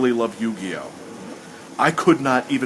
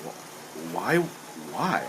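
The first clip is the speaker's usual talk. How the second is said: bewildered why; 8 semitones lower, 8 dB quieter, much slower than usual. Retold or imitated. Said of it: "And I still went".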